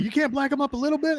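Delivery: high wheedling buddy voice; friend's voice. Retold or imitated. Friend coaxes him, high wheedling buddy voice. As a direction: coax like the pal.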